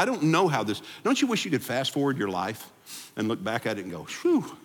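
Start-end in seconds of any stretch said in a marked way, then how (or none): 4.0-4.6 very high sigh of relief; relieved phew. Pretend. Pastor imagines looking back on life, high relieved phew. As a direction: blow out relief.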